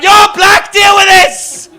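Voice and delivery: loud, high panicked yell; panicked shout. Retold or imitated. Comedian acting his own panicked shout, pushing his friend forward. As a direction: shove him forward and shout. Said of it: "I went".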